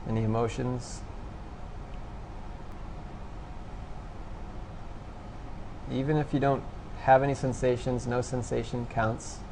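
soft, low-key meditation guidance with long pauses; guided meditation. Real, not acted. Instructor guiding listeners to notice thoughts and sensations, quiet meditation voice. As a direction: gently prompt them, leaving long silences. Leads a meditation after breathing exercise.

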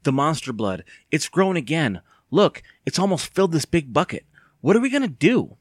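alarmed. Acted. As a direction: build to a desperate question. Panicking over growing monster blood.

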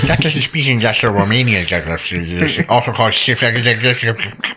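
slow, pedantic know-it-all; nerd voice. Retold or imitated. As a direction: lecture it like a smug nerd. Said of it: "Cue the nerd voice".

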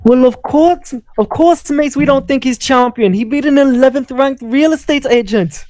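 high, put-on Irish accent drifting toward Scottish and Jamaican; a failed Irish accent, landing somewhere Scottish; botched Irish accent. Mocking Khabib's title as Conor might, in a botched Irish accent. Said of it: "the goal was Irish".

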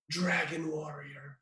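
put-on slow, soft, deadpan line with no accent; mock flat movie line; flat American delivery. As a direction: say it flat and quiet like a bored American. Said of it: "without any inflection, any hint of an accent".

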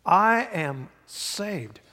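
slow, emphatic proclamation; preaching declaration. Real, not acted. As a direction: declare it slowly with full conviction. Leads a declaration.

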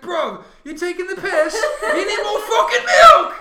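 high, loud, hammed-up British accent; Taika Waititi. Director forces milk, shouted mock-British accent. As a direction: yell it in a put-on UK accent. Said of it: "pretend Taika Waititi's from the U K".

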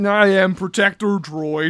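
slow, deliberate robot voice; drawn-out droid announcement; sparking droid. Acted. Game master joking the guard melts, droid voice. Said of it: "sparking a little bit as he goes".

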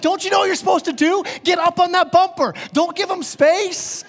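high, loud exasperated rant; hammed-up fury at drivers who let others in; mock road rage. Preacher mocking his own road rage at lenient drivers, high and loud. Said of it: "what makes me so mad".

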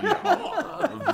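high-pitched, drawn-out British "yeah"; a silly one-word British accent; mock British accent. Assassin who says nothing in a trench coat, mock British. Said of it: "Very British".

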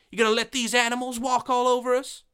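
high, fast, indignant woman's voice; wife rounding on the sheriff, high and furious; angry Laura. Acted. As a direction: demand it of the sheriff, outraged.

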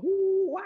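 loud high ape hoots; ape sounds. Retold or imitated. Host showing the room his ape call, loud hoots. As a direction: hoot like an ape in a crowd. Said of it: "we would do ape sounds".

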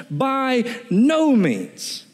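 mock-indignant "By no means"; outraged refusal. Shown as a demonstration. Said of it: "It carries a sense of outrage".

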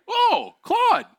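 very high, loud surprised greeting; dad hits a high note of surprise. Retold or imitated. Dad spotting his son in the snow, high surprised call. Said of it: "And he's like".

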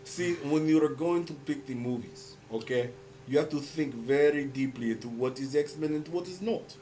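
put-on Russian accent. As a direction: lecture him gravely in a thick Russian accent. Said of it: "That's a good Russian voice".

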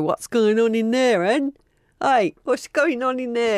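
put-on slow, high northern English accent. Nosy neighbour calling out at a night exhumation, in a northern English accent. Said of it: "You keep doing that accent".

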